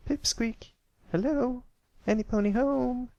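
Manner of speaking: high, bright-eyed filly calling out; young filly voice. Acted. Apple Bloom calls to daydreaming Pipsqueak, high filly voice. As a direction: call him back from his daydream.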